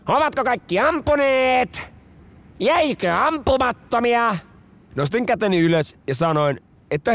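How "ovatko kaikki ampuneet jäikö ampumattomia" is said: high, slow called-out question; officer calling out. Retold or imitated. Lieutenant checking the line after firing, called out. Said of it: "yliluutnantti huusi".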